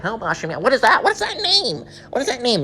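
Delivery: high, incredulous questioning; mock baffled passerby. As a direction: imitate a stranger freaking out over a strange name. Mocks passerby baffled by the name.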